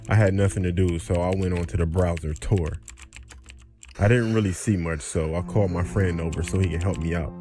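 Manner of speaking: ordinary delivery, nothing marked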